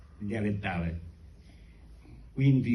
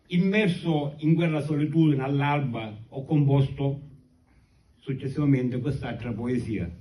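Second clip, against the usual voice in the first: ordinary talk, nothing marked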